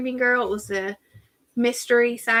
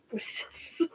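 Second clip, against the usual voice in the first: whisper; 11 dB quieter, faster than usual. Real, not acted. Puzzling over an item.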